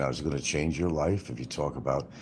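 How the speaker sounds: ordinary delivery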